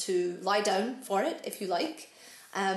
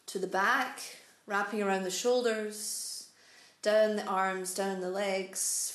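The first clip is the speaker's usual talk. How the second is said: calm meditation voice, spacious pauses; unhurried, soothing instructions, letting each phrase settle; guided meditation. Real, not acted. Guides breath and body relaxation.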